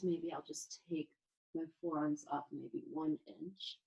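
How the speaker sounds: ordinary talk, nothing marked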